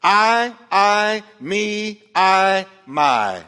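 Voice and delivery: counting off selfish pronouns; stress each pronoun. Shown as a demonstration. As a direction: punch every I, me, my loud and high. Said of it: "Five personal pronouns".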